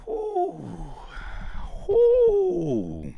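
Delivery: high falsetto whoo; falsetto whoop testing voice. Shown as a demonstration. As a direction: whoop high to test what's left of the voice. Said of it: "My voice".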